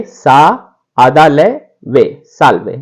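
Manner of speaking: साल्वे broken into syllables, then said whole; teacher breaking a word into syllables; Italian pronunciation. Shown as a demonstration. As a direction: sound out साल्वे piece by piece, then join it. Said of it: "और इसको लिखेंगे".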